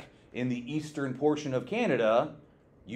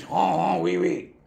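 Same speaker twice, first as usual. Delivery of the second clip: a hammed-up French accent, louder; mock French; 4 semitones higher, 5 dB louder than usual. Teacher to class, joking about a French explorer, mock French accent. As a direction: play a cartoon Frenchman. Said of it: "one hundred percent French".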